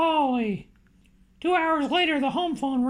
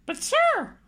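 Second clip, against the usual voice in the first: high-pitched startled protest; squeaking up in pitch; voice shooting up; 11 semitones higher than usual. A narrator's reading. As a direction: let the voice leap way up in disbelief. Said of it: "heard his voice go up a couple of octaves".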